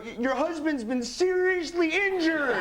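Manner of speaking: high, half-laughing grave news; mock-serious high voice with a laugh; high stranger voice. Bystander tells wife of injury, high and laughing. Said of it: "he said to her".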